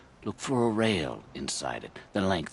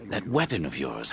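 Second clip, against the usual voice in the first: ordinary talk, nothing marked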